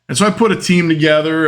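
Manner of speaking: ordinary delivery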